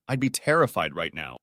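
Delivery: ordinary delivery, nothing marked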